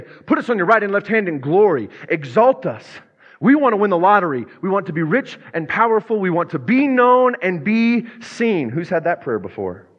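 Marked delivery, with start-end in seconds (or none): 0.0-8.7 higher, louder grasping demand; mock-greedy wish list, stretched at the end; greedy disciples. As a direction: play the disciples begging for glory. Disciples' greedy request, acted.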